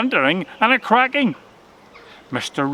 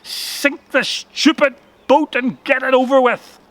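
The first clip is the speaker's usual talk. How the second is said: angry; 7 semitones higher, 3 dB louder than usual. A narrator's reading. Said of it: "he was angry with the whole thing now".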